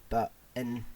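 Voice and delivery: ordinary talk, nothing marked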